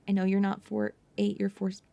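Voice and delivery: ordinary delivery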